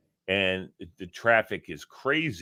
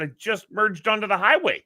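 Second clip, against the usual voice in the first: pissy retort; 10 semitones higher than usual. Retold or imitated. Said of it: "I was pissy".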